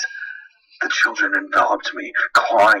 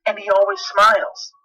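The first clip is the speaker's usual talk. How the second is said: put-on higher small-boy voice describing a clown; child voice; 10 semitones higher than usual. James describes Laughing Jack to his dad, higher child voice. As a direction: play the little boy, innocent and eager. Son describes imaginary friend.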